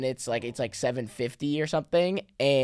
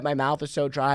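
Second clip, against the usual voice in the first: ordinary talk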